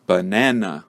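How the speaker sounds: "banana" with the middle syllable na stressed and the schwas reduced. Shown as a demonstration. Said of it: "the second middle syllable will be stressed".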